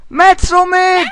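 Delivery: high excited shout. Real, not acted. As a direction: yell it gleefully. Enthusiastic answer.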